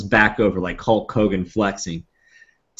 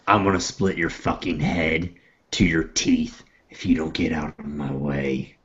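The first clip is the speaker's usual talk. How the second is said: low, controlled threat in character; quiet menace, pitched down; menacing threat; 4 semitones lower, 4 dB quieter than usual. Acted. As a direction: keep it low and deadly serious. Nog threatens the man.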